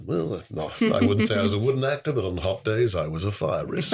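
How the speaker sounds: deep, smooth Roger Moore voice; Roger Moore impression. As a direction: drop low and deadpan the joke as Roger Moore. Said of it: "that's a deep voice".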